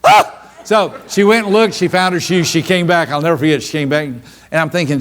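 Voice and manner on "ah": very high, loud squealed "Ah!"; high startled squeal. Retold or imitated. Daughter shocked at crawling under stalls, high squeal. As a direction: squeal it in mock horror. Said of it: "She said".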